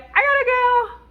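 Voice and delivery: high, sugary fake-sweet goodbye; fake sweet smiling. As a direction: beam and gush it with a forced smile. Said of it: "I'll be smiling and I'll be like".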